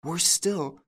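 high, dismayed exclamation; dawning horror. A narrator's reading. Jeanne breaks off at a worse thought, horrified. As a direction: lift it high on a fresh fear. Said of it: "she gasped".

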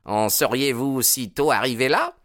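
high, loud mocking taunt; jealous jeer at the first name; sneering. A narrator's reading. Baldwin hearing her call him Jack, sneering.

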